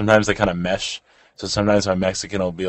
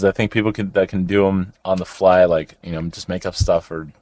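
usual speaking voice

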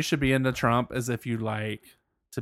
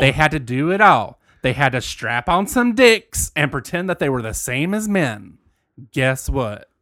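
country voice. Acted. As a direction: read it as a fed-up country ranter. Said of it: "I'm gonna say this in my country voice".

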